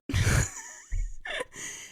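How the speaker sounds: high squealing burst of laughter; relieved laugh. Real, not acted. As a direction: let out a shrill laugh of relief. Laugh after car stops.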